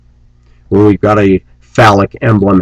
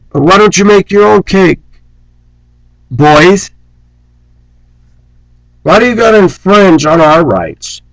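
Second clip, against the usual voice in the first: high scornful challenge; 9 semitones higher than usual. Acted.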